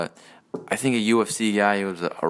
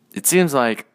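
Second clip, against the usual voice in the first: ordinary talk, nothing marked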